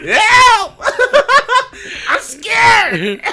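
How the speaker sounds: very high, loud, laughing wail; hammed-up terrified scream to mom; mock wailing cry for help. Retold panicked cry for help.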